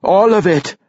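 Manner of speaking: high, loud emphatic answer; worked-up young dwarf insisting; insistent. A narrator's reading. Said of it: "Bruner insisted".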